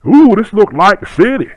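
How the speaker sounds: put-on high, awed country-kid voice; wide-eyed kid. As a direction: marvel at the city like a child. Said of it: "I really used to talk like that too".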